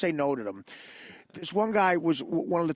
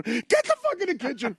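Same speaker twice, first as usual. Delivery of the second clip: high barked yell; father yelling; 8 semitones higher than usual. Retold or imitated. Father yelling at his kid across the house, raised high. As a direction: bark it like an angry dad. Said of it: "your father was going".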